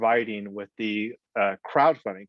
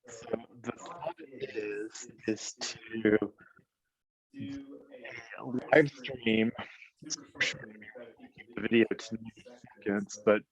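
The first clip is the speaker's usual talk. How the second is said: underwater audio; 5 dB quieter than usual. Real, not acted. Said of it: "you sound like you're underwater".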